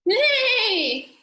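high, drawn-out neigh; horse neigh. A narrator's reading. Teacher voicing the horse for pupils, high neigh. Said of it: "said the horse".

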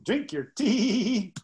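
slow, high drawn-out drink your tea; mock towhee call; comical towhee song. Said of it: "the most comical song".

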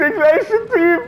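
high, slow, outraged shout; shouting orders over battle. Real, not acted. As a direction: drag it out in disbelief. Excited outburst about enemy player.

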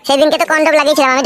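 usual speaking voice

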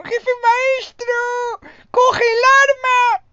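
very high, slow, shrill squeal of a cheap dub; mocking dub voice. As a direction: squeal like a stingy puppet. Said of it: "Con la voz de muñeco rácano".

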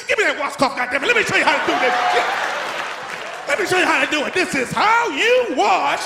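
scrub-lesson yelling; angry grandma. Retold or imitated. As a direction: grandmother showing a kid how to wash. Grandma scolding at tub.

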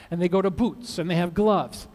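ordinary talk

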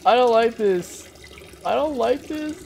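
high, loud nervous oh no with a laugh; half-laughing dread at something creepy; nervous panic. Real, not acted. Gamer spooked by the game, nervous laughing panic.

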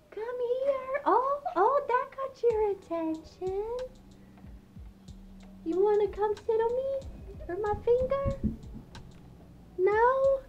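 very high coaxing voice; high-pitched, gentle invitations to a pet; pet talk. Real, not acted. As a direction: lure a bird closer with high cooing. Coaxes her pet birds.

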